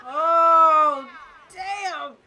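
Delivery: long, loud drawn-out cry of shock; big stunned outburst; shocked exclamation. Real, not acted. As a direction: hold the first word loud, then react. Reacting to a game crash.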